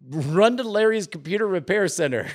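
barely holding back laughter; laughing while reading. Real, not acted. Host laughing at his own silly command.

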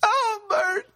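very high, sobbing call; grief-stricken crying shout; tearful scream. A narrator's reading. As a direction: wail the name through tears. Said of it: "she shouted between tear-filled sobs".

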